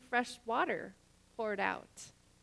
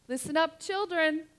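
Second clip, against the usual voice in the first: calling out; 5 semitones higher, 5 dB louder than usual. Real, not acted. Preacher calls congregation to listen.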